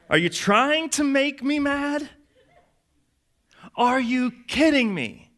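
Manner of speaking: very high, drawn-out mock outrage; incensed parent. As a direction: stretch each question out in exasperation. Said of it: "a parent or a boss asking these rhetorical questions".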